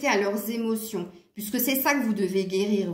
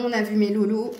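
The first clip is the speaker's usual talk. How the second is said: usual speaking voice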